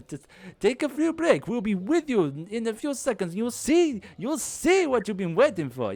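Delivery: high, loud call to the crowd; showman stalling. Acted. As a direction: stall the audience with forced cheer.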